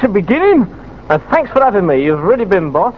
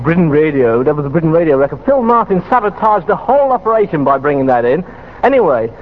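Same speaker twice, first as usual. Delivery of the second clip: ordinary talk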